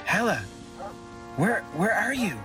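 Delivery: high, worried call; anxious calling. Acted. Fathorn looking for missing Hela, anxious high call. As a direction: call out, searching uneasily.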